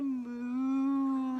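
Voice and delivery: loud, long-held moo; cow moo. Pretend. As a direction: draw out a playful cow call. Acting a jungle cow.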